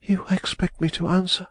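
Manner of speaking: high, disbelieving question; incredulous exclamation. A narrator's reading. Woman exclaiming at Raymond's demand, high and incredulous. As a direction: cannot believe he asks this now. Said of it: "she exclaimed".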